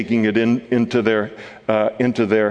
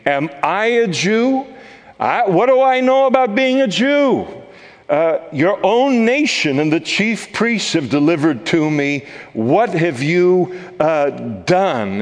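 higher, scoffing Pilate brushing the question off; incredulous retort; 5 semitones higher than usual. Acted. Pilate answering Jesus, a scoffing incredulous retort. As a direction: toss it back with disbelief.

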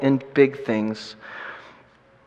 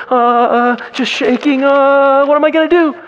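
nervous imitation; 10 semitones higher, 4 dB louder than usual. Voicing nervous young evangelist.

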